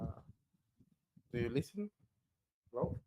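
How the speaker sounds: barely audible connection; faint voice on a bad line; very low volume. Real, not acted. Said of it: "voice went very low in volume".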